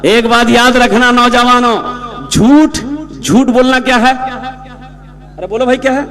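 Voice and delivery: ordinary delivery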